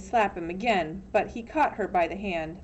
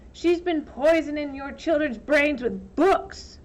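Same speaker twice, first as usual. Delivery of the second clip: declared; 6 semitones higher, 4 dB louder than usual. Acted. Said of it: "Trout declared".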